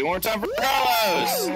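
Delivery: shouted high hype for the comic; rally the crowd for applause; hype call. Real, not acted. Host hypes crowd applause.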